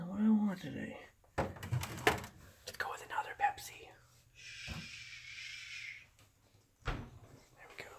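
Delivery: soft unvoiced whisper. Real, not acted. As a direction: hush it so nobody wakes. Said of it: "I don't wanna wake them up".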